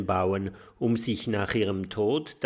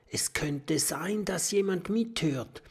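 high whisper; 8 semitones higher than usual. A narrator's reading. Professor warns the friends, whispering.

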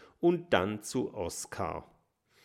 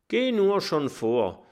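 with full mouth; 7 semitones higher, 4 dB louder than usual. A narrator's reading. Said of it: "sagt Oskar mit vollem Mund".

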